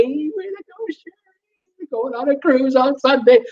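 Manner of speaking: high, thin, gushing voice for a viewer; excited squeal. Pretend. Host voicing a cheering viewer, gushing high squeal. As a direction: squeal it like a thrilled fan.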